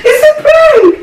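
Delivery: laughing. Real, not acted. Revealing the prank.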